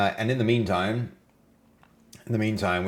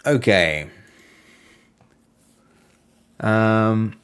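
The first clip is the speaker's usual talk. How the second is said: usual speaking voice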